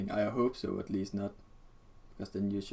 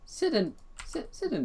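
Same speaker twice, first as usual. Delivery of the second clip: silly high voice. Pretend. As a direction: pitch it way up and drag it. Playful silly command.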